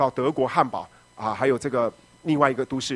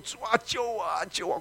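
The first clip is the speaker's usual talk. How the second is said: tearful quiet plea; 8 semitones higher, 6 dB quieter than usual. Retold or imitated. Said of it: "哭啊".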